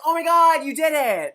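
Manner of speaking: loud, high burst of joy; excited. Retold or imitated. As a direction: shout it like the miracle just happened. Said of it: "He gets so excited".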